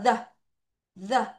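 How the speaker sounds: The with tongue pulled from between teeth; TH sound demo. Shown as a demonstration. Teacher shows pupils the TH, The said with tongue between teeth. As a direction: isolate The, a voiced tongue-tip TH. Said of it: "T H는 혀를 이 사이에 넣다 빼죠".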